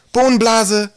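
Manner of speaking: high, loud victory cry; happy shout. A narrator's reading. Twig celebrating his throw, shouting happily. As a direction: call out in triumph. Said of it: "rief er und sah sich glücklich um".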